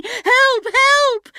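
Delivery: high, loud cries for help across the empty desert; shouting for help. Acted. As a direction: yell out, lost and panicking. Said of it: "shouting, calling".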